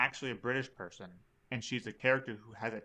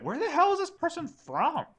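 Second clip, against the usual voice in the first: high-pitched incredulous outburst; exaggerated bafflement; 4 dB louder than usual. Viewer puzzled by a terrible accent, retold incredulously. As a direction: voice shooting up in mock disbelief.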